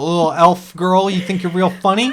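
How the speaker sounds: the GM as a prickly cursed oyster, lightly voiced; indignant oyster. GM voicing the oyster, indignant at the elf girl. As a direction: bristle back like an insulted little shellfish.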